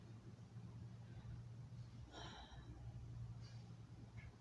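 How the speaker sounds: long trembling sigh; shaky sigh. Real, not acted. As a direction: sigh out the grief. Long sigh while near tears.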